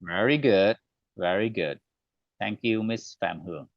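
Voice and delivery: ordinary delivery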